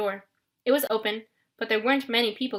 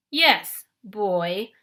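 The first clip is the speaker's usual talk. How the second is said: cruel sneer; 4 dB louder than usual. Acted. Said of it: "with a cruel smile".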